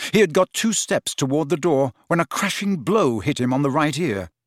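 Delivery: usual speaking voice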